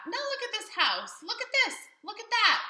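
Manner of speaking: very high, loud scolding; scolding fish. Acted. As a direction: scold the cat for the mess.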